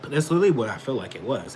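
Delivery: usual speaking voice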